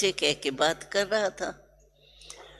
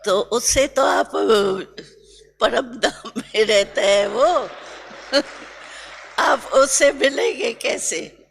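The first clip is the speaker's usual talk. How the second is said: amused; 4 semitones higher, 5 dB louder than usual. Retold or imitated. Baba laughing at the request.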